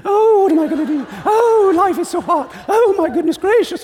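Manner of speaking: high, loud, hammed-up girly wail; mock clueless princess. Preacher mocking a movie princess, hammed-up high wail. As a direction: play the lost princess who doesn't know she's royal. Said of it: "She's going".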